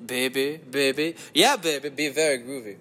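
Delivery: put-on groovy catchphrase in a German accent; lightly hammed swinger patter; Austin Powers. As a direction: play up each "baby" in mock-German. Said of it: "the Austin Powers version of a German accent".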